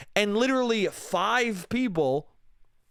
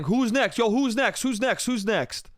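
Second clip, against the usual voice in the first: retold shout, raised pitch, repeated insistently; mock shouting; 5 semitones higher than usual. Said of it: "he just starts shouting".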